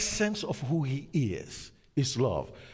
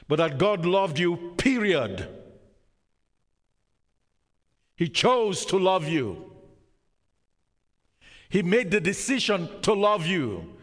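loud preaching voice driving each point home; fervent preaching; 7 semitones higher, 4 dB louder than usual. Acted. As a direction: preach it out loud to the whole church. Preacher building to full force.

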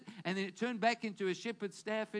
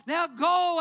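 God booms out the order, much louder and higher; thundering divine order; commanding shout; 7 semitones higher, 11 dB louder than usual. Acted. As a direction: send him off with a loud command.

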